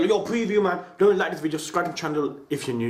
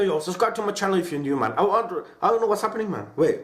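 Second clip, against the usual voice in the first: ordinary talk, nothing marked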